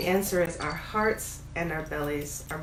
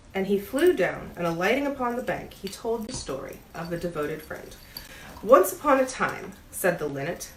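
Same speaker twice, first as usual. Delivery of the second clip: bedtime story read aloud with lightly voiced animals; story narration. A narrator's reading. Bookseller reads Oscar Wilde to the shop cat, storytelling voice. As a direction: read it like a gentle fireside tale.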